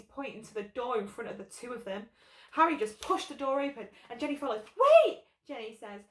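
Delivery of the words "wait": much higher, loud cry of wait; sudden loud call; urgent shout. A narrator's reading. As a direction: yell it to stop them. Character stops others urgently.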